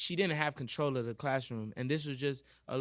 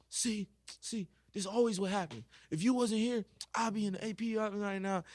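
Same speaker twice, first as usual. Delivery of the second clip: upset student; 7 semitones higher than usual. Retold or imitated. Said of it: "It's like".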